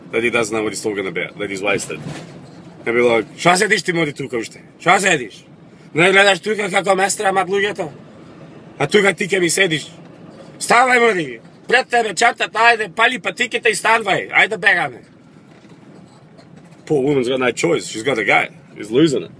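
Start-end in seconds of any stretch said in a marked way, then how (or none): 3.4-15.0 higher-pitched angry scolding; drunk husband's rant. Acted. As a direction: crack it at the wife, building higher. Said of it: "cracks it at her like it's her fault".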